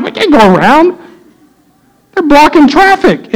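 high-pitched, acted exasperation; exasperated retort. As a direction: argue back, re-enacting the squabble. Acted reply in car quarrel.

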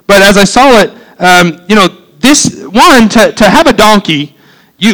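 rising preaching. Acted. Pastor to congregation, driving the point home, fervent preaching. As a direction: preach it out with growing passion. Preaching builds to full force.

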